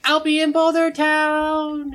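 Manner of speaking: high, slow, sustained holler; distant call. Acted. Sushi Kid waving to departing travellers, long high shout. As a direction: sing it out loud across a long distance.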